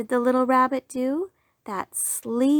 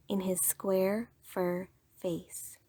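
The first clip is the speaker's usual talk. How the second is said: whisper; 2 semitones lower, 7 dB quieter, slower than usual. A narrator's reading. Soft poem ending.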